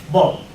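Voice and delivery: "Bob" with a B in place of P. Shown as a demonstration. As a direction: mispronounce pop as "Bob". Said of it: "Now what is gonna say?".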